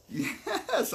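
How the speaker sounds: high-pitched, half-laughing yes; exasperated yes. Retold or imitated. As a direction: answer an absurdly obvious question. Exasperated answer to obvious questions.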